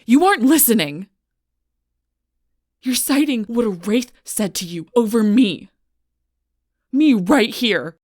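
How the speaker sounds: loud, high shout in anger; angry outburst. Acted. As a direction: yell it in full exasperation. Heated argument erupts.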